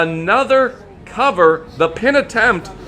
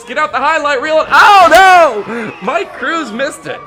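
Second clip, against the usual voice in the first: loud, high, fast excited call; excited announcer shout; 6 semitones higher, 7 dB louder than usual. Real, not acted. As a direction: call the miss in sudden shock. Big move missed in the match.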